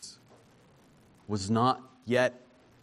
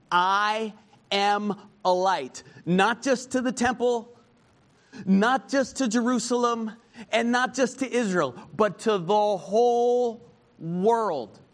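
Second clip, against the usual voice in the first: much higher and louder, slow and soaring; preaching at full force; 8 semitones higher, 5 dB louder than usual. Acted. Preacher proclaiming Jesus as light of world, soaring preaching. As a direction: build it to a soaring climax.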